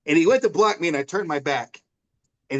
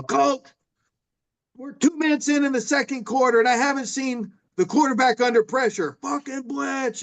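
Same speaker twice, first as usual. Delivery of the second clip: yelling from the stands; 10 semitones higher than usual. Retold or imitated. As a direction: call out to the coach from the bleachers, much higher. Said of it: "I'm yelling".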